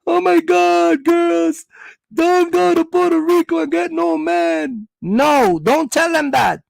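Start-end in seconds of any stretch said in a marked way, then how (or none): 0.1-4.9 mock social media rant. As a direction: shout it high like a scorned woman online. Woman warning on social media.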